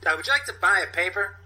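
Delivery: high, fast, whiny street hustler; Steve Buscemi impression. Sasha the newsstand hustler snapping at a snobby woman, high and fast. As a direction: pitch it up and rattle it off like a pushy newspaper hawker. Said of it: "I have a good Steve Buscemi impersonation".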